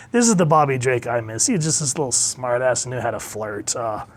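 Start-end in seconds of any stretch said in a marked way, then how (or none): none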